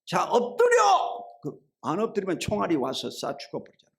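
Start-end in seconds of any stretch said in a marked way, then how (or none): 0.0-1.4 high, loud, drawn-out command yell; acted officer's urgent shout; shouted battle order. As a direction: yell it over gunfire to your troops. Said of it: "작전 명령을 하면요".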